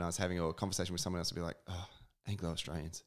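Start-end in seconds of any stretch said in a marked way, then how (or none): 1.7-3.0 quiet, weary mock disapproval; head-shaking dismay. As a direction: say it like you're shaking your head. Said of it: "with a shaking head".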